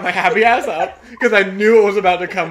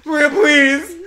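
loud, high acted plea; begging; 7 semitones higher, 7 dB louder, slower than usual. Begging a knocked-out friend for help, loud comic pleading. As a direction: plead with her, half laughing. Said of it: "I was literally begging".

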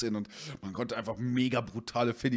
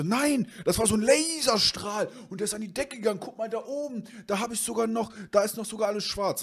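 insisting friend; 10 semitones higher than usual. Retold or imitated. Friend defending his story to the owner, high and pleading.

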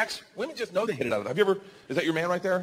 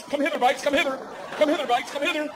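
high sing-song come hither; hammed-up beckoning; mock seductive call; 10 semitones higher than usual. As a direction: coax the bikes like a lover.

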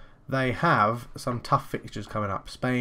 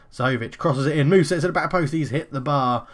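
louder, rising excitement at a near goal; excited play-by-play; 4 semitones higher, 5 dB louder than usual. Real, not acted. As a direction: call the chance as it peaks. Live commentary on a near goal.

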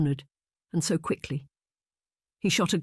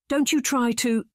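higher, sharp angry outburst; 9 semitones higher, 3 dB louder than usual. A narrator's reading. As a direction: snap at the girl. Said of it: "Missus Wickliffe began".

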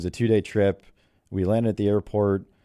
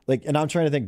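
ordinary delivery

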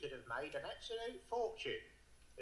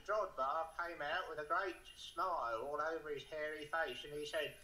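ordinary talk

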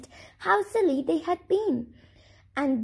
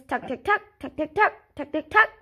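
chicken clucking; 2 semitones higher, faster than usual. A narrator's reading. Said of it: "he sounded just like the chicken".

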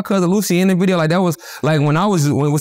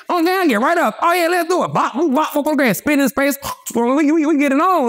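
pitched well up, fired-up fight story; excited fight retelling; 10 semitones higher than usual. Retold or imitated. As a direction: hype up the fight.

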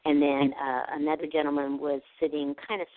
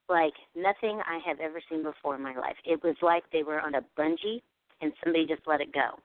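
normal talking voice

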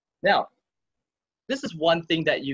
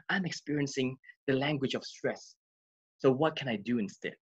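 softer, measured self-observation; softer self-talk; 7 dB quieter than usual. Shown as a demonstration. Coach modelling quiet self-talk for viewers. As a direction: speak it gently to yourself. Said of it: "you can ask yourself these questions".

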